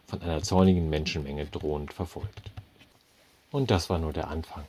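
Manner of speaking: ordinary delivery, nothing marked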